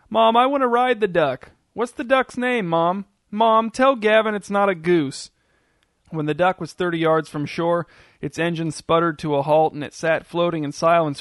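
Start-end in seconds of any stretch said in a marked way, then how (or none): 0.1-5.3 put-on higher-pitched, eager kid demands; children calling to mom. A narrator's reading. Children on the beach beg their mothers about the duck, calling out. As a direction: call out like a child pestering mom. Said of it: "calling requests and demands back to their mothers".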